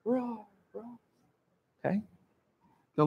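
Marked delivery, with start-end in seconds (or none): 0.0-1.0 echoing roars. Shown as a demonstration. Lion roaring into the well, echo fading. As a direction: start big, then let the echoes die away. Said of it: "There's an echo".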